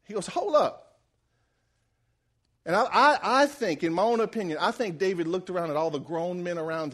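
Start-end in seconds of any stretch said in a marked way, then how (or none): 0.3-1.0 high-pitched mock disbelief; incredulous double take. David hears the giant's insults, incredulous. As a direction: stop them short, voice jumping up. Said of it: "when somebody says something stupid and you're like".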